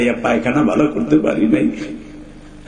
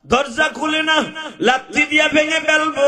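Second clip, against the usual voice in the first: much higher, ringing preacher call; rising sermon cry; 13 semitones higher than usual. Acted. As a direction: lift the pitch into a cry. (উঁচু সুরে ওয়াজ)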